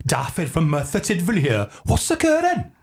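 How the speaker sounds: put-on high, lilting comic Welsh accent; Daffy. Guest as donut-shop alter ego greeting listeners, comic Welsh accent. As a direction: swoop the questions up in cheery Welsh. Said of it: "fairly typical sort of Welsh".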